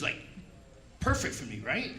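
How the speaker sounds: ordinary delivery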